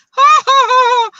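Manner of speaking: loud, high mock cackle; imitating her own horrible laugh; hammed-up high ha-ha-ha. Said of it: "how horribly I laugh 'cause I'm there going".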